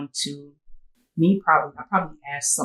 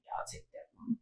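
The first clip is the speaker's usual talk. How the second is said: voiceless near-whisper, much higher; hushed acceptance; 16 dB quieter, faster than usual. Retold or imitated. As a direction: breathe it out softly, almost a whisper. Accepts the bracelet at the funeral.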